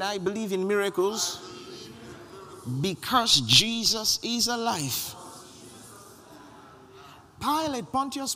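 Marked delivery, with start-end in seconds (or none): 0.0-5.1 louder and slower, drawing the words out for the crowd; preacher pushing them to say it again; louder, slower repeat. Shown as a demonstration. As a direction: rouse the congregation on the repeat. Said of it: "Come on, say it again".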